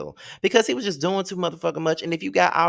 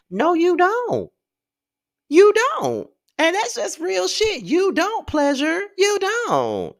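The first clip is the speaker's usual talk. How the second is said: mocking denial; 14 semitones higher, 4 dB louder, slower than usual. Calling out Pleasure directly.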